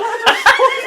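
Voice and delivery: loud, high laughing fit; helpless high cackle; high burst of laughter. Real, not acted. Podcaster losing it at the bladder joke, loud laughter. Group cracking up.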